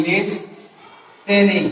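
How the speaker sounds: লিস্টেনিং slowly as lis, pause, te-ning; slow লিস্টেনিং with a hard t after the break; mispronunciation, split. Shown as a demonstration. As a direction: sound the t in লিস্টেনিং, drawn out in parts. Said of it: "অনেকে বলে লিস্টেনিং".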